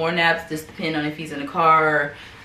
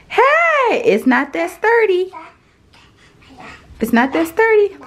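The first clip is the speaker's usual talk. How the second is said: alarmed warning; 11 semitones higher, 5 dB louder, slower than usual. Real, not acted. Baby pulling up on furniture.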